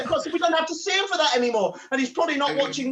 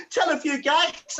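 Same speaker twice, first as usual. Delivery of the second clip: ordinary talk, nothing marked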